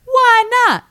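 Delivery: very high, shouted relay; loud bystander. Retold or imitated. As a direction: holler the question, exaggerated and high.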